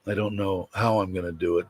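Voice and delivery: normal talking voice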